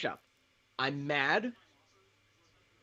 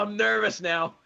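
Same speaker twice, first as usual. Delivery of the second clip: higher and louder, laughing through nerves; laughing nervously; 6 semitones higher, 6 dB louder than usual. Real, not acted. Fan awaiting his team's pick, laughing nervously.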